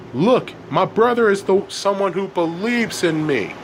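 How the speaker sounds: mildly pleading protest; insistent plea. A narrator's reading. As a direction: make the parents listen. Elliot pleads with parents.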